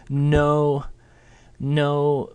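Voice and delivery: high, held level no. Shown as a demonstration. Teacher showing a level no. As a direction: keep no flat and high. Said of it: "in this kind of level tone".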